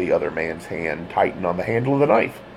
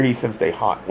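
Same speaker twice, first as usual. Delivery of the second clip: usual speaking voice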